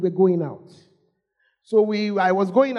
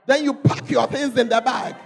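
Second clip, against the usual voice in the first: normal talking voice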